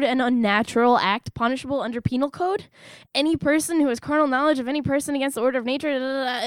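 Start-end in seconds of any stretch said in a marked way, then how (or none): 3.1-6.5 rattled-off statute reading; skimming legal text. Acted. Reader skimming the penal code for herself, rapid and dismissive. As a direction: race through it, trailing into filler.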